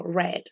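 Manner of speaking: "red" with a short e, like the colour; past-tense read. Shown as a demonstration. Teacher likens the past form to a colour, past-tense read. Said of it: "como el color rojo".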